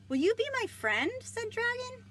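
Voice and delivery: high, loud, pleading Dragon voice; hopeful dragon. Acted. Dragon asking the squirrel for friendship, high and hopeful. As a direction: make him sound eager for a friend.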